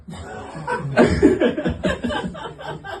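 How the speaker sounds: breathy high giggle into the mic; airy laugh. Real, not acted. Laughs at own opening.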